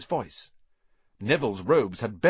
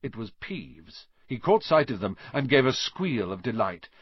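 ordinary delivery

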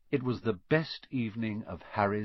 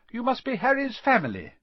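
much higher, kindly mother voice; motherly Mrs Weasley; 11 semitones higher, 4 dB louder, faster than usual. A narrator's reading. Mrs Weasley greets the boys, higher and kind. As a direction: smile down at the boys.

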